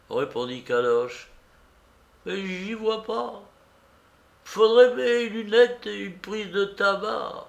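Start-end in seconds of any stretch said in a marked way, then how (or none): 2.2-7.4 put-on high-pitched querulous old man; old beggar. Beggar asking for glasses and snuff, high querulous voice. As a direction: complain in a high old man's voice.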